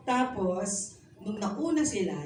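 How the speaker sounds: usual speaking voice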